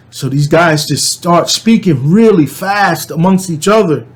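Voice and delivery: usual speaking voice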